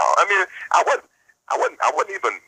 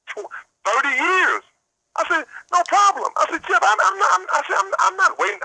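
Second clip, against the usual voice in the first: much higher and rising, pushing hard; heated retort. Retold or imitated. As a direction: push the voice up, indignant. Heated retelling of the phone call.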